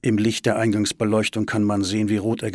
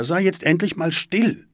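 higher, exasperated shushing; sharp rebuke; 8 semitones higher, 4 dB louder than usual. A narrator's reading. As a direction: snap at your mother to shut up. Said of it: "sie verbitte sich diesen Ton". (genervt und patzig)

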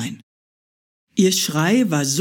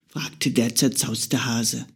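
ordinary delivery, nothing marked

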